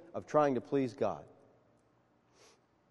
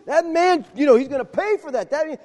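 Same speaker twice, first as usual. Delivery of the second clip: much higher and louder, an outraged burst; acted outrage at the rich man's injustice; enraged king; 8 semitones higher, 6 dB louder than usual. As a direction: voice David boiling over at the rich man. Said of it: "he's enraged".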